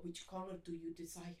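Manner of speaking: put-on low, slow, soft Russian accent. Russian framer asks customer about color, imitated Russian accent. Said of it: "eu imitava o sotaque".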